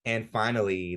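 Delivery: ordinary talk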